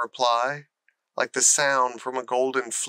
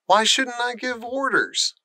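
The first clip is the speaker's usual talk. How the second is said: sharp, much higher retort; snapped; 10 semitones higher than usual. Acted. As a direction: bite back at her. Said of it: "snapped Colin".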